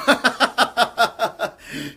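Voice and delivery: bursts into a high, loud laugh. Real, not acted. Medium laughing at ghost's joke.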